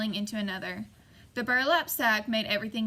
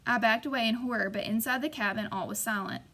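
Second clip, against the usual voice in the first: usual speaking voice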